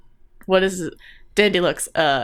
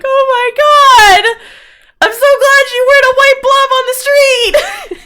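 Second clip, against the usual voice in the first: high, loud, hammed-up crying; tearful relief; 11 dB louder than usual. Sister cries on finding her brother, wailing high. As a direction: wail it out at a very high pitch. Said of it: "she's, like, crying".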